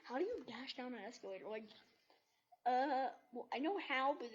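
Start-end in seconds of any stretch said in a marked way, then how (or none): none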